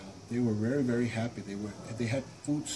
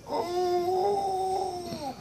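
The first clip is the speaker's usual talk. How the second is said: pained monster groan; 4 dB louder than usual. Acted.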